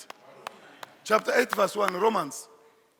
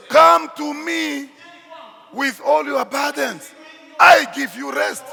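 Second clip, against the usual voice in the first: loud, raised call, opening words stretched out; proclaiming; 3 semitones higher, 7 dB louder than usual. Acted. Preacher voicing Jesus calling the burdened, loud proclamation. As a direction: call out an invitation to a whole crowd.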